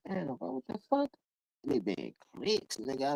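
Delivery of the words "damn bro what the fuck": surprised reaction. Retold or imitated. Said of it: "I be like".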